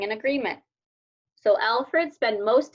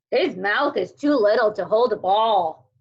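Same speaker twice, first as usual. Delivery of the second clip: sneer; 2 semitones lower, 4 dB louder than usual. A narrator's reading.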